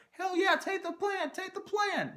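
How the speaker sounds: squeaky, very high-pitched hyped-up imitation; mock excited agent. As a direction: put on a tiny, super-high cheerleading voice. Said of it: "she's that excited".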